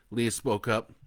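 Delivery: ordinary delivery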